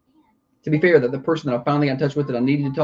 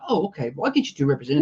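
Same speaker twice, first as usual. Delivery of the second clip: mock virtual assistant; 8 semitones higher, much faster than usual. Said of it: "it's like".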